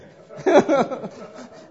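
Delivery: high, loud falling laugh; burst of laughter. Real, not acted. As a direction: laugh at yourself. Laughs at realizing being a sinner.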